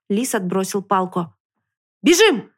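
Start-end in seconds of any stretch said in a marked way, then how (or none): 2.0-2.5 громкий резкий выкрик; гаркни другу: бежим; гаркнуть. A narrator's reading. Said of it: "гаркнул он". (громко гаркнуть)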